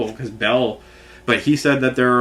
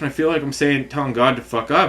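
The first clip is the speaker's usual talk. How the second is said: ordinary talk, nothing marked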